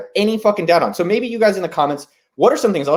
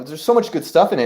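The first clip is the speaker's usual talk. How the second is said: ordinary delivery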